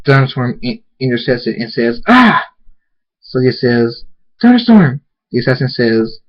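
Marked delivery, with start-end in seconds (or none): 2.1-2.5 high, loud "ah" of pain; acted cry of being struck. Hit by a dart. 4.4-5.0 high, loud shout of a name; horrified outcry; alarmed cry. Acted. Cries out as friend is hit.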